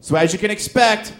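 hammed-up stage delivery into the punchline; formulaic stand-up comic. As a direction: copy the hyped comics, high and loud. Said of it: "I went up on stage. I'm like".

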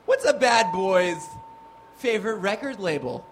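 ordinary talk, nothing marked

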